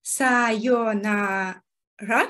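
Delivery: さよなら repeated slowly after the teacher. Shown as a demonstration. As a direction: echo さよなら slowly, carefully.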